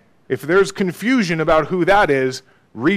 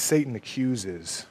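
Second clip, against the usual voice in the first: ordinary delivery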